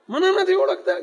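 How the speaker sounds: high, indignant ruler's retort; arrogant boast. Acted. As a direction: take offence that they don't know you. Prince boasting his rank.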